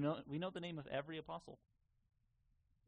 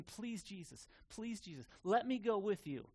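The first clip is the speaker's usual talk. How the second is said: higher-pitched plea building to a loud insistence; begging; 5 semitones higher, 3 dB louder than usual. Retold or imitated. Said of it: "he's begging Jesus".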